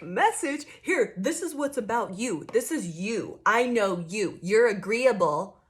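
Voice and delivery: loud, pointed mock accusation; mocking accuser. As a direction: jab each line at them like a know-it-all. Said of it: "somebody tried to bring you a message and be like".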